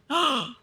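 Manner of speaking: startled loud gasp; a sudden frightened intake of breath; sharp gasp. Real, not acted. Startled by something on screen.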